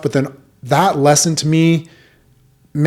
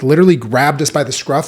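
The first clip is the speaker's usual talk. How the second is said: ordinary talk, nothing marked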